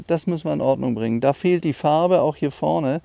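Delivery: ordinary talk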